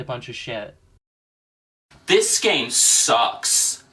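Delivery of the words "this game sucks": gruff character voice. Acted. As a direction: drag out a disgusted complaint. Skit character complains about game.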